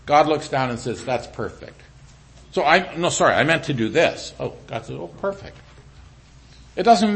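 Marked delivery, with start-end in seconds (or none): 1.0-1.8 hushed, gentle "That's perfect"; soft approving God. Acted. Preacher voicing God's calm approval, hushed. God's reply voiced softly. 5.0-5.6 hushed, gentle "That's perfect"; soft approving God. Acted. Preacher voicing God's calm approval, hushed. God's reply voiced softly.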